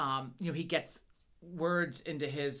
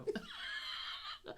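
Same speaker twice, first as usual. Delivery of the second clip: imitated kid howling laugh; 13 dB quieter than usual.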